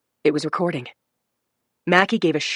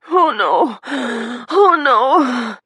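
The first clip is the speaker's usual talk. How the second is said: higher, louder despairing cry; fearful moan; 6 semitones higher, 4 dB louder, much slower than usual. A narrator's reading. Injured woman despairing on the board. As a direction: moan in dread. Patient in fear.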